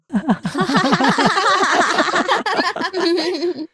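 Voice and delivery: teasing laugh. Acted. Teasing the shy pair.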